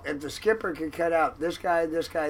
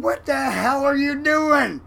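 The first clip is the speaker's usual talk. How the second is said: shaken outburst; 11 semitones higher, 9 dB louder than usual. Retold or imitated. Startled cook demands an answer.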